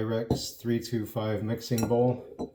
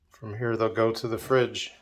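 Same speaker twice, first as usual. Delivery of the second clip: ordinary delivery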